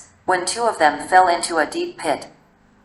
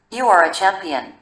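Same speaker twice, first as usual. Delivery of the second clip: usual speaking voice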